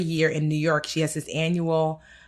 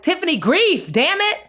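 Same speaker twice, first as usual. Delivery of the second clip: self-scolding outburst; 11 semitones higher than usual. Retold or imitated. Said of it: "I'm like".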